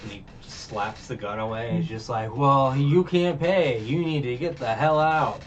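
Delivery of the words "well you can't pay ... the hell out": slow, louder rehearsed threat, every word set up like a line practiced in the mirror; rehearsed tough guy. Retold or imitated. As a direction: play the bouncer who rehearsed this in a mirror. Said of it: "like he was practicing in the mirror".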